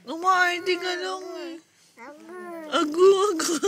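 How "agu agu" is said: put-on loud, higher baby cooing; imitating baby babble. Parent echoing baby's babble, playful cooing. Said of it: "Lagi siyang agu".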